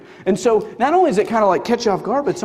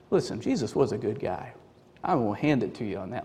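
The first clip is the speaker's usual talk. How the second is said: low, soft, offhand admission; laid-back, lower-pitched concession; casual concession; 9 semitones lower, 8 dB quieter than usual. Acted. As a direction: grant it coolly, like a reasonable doubter. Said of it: "some of you in here this morning go like".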